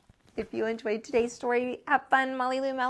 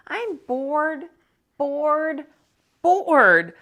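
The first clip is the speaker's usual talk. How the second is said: a hint of a whining bored girl, rising on the last; whiny bored girl; 6 semitones higher, 6 dB louder, much slower than usual. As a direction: drag out each bored like a fed-up kid.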